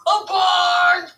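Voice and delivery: high, loud, slow yell; shouted punchline. Pretend. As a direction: bellow the punchline like a game show answer. Shouting answer louder.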